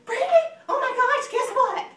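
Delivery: high, loud, bursting with news; thrilled, breathless-to-tell phone greeting; excited friend calling. Shown as a demonstration. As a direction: shriek it like you have huge gossip. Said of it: "your friend calls you excited".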